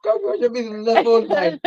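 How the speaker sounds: high, squealing laughter through words; cracking up while talking; laughing hard. Real, not acted. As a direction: barely get the words out for laughing.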